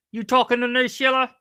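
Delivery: tough-guy movie impression. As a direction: lightly throw the challenge like a movie hard man. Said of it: "that was pretty good".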